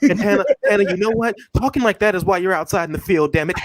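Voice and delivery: high, mock-proper scolding. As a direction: scold in a high code-switched voice.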